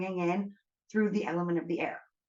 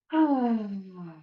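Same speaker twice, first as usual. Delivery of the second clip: long loud sigh. Shown as a demonstration. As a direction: let it all out in a big sigh. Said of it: "let out, like, a long, loud sigh".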